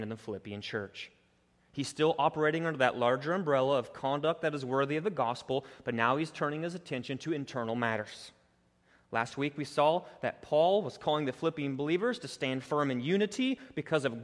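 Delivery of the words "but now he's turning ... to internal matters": ordinary talk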